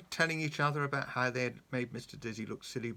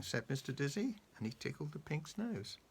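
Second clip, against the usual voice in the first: ordinary delivery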